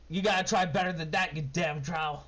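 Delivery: mock the drow's aim; taunting halfling. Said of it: "He goes".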